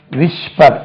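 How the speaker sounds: "whisper" as "wishpar", with sh for s; old schoolroom mispronunciation. Retold or imitated. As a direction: recall the learner's "whisper" with sh and a full final r. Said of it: "விஸ்பர் என்று உச்சரிப்போம்". ("whisper" என்பதை பழைய பாணியில் "விஷ்பர்" என்று)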